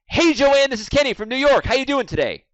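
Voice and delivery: high, loud, bouncy phone greeting; over-hyped caller. Acted. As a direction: blast the greeting with too much energy. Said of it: "Out of rapport".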